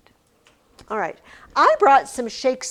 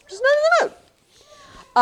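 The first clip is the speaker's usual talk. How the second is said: very high, louder, quick squeaked aside; mock sing-song squeak; 7 dB louder, faster than usual. Teacher declining to berate people, playful high squeak. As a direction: pitch it way up and loud, playfully. Playful repeat of not being in the mood.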